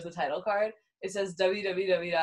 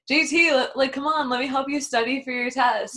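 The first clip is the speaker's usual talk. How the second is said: higher, louder nagging plea; a nagging classmate badgering JT to study; 6 semitones higher, 5 dB louder than usual. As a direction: pester him to study. Liberty nagging JT.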